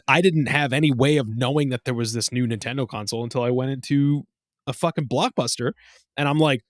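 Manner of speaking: ordinary talk, nothing marked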